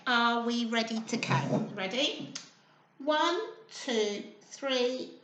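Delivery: plain reading voice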